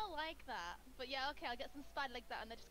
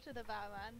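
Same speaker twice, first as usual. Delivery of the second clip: ordinary talk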